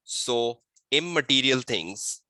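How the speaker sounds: slow, measured dictation with long pauses. Real, not acted. Teacher dictating a model exam answer to students, slow and deliberate. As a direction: dictate word group by word group for note-takers.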